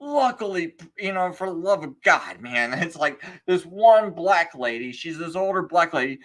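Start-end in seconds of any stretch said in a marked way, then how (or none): none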